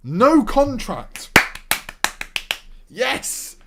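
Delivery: high, loud, slow savouring; delighted exclaim. Real, not acted. Manager spots a free signing, loud slow delight. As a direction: relish every word.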